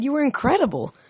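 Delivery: high-pitched, admiring exclamation; high, eager compliment; excited praise. Acted. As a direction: gush with admiration.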